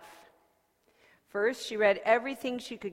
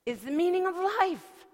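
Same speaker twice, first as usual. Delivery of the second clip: breathless, high and loud; excited; 9 semitones higher, 5 dB louder than usual. Acted. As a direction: blurt it out, out of breath. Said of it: "out of breath 'cause she was excited".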